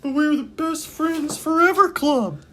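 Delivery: very high drawn-out cheer; high slow cheer. Pretend. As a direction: proclaim it like a cartoon kid. Chanting friendship club name.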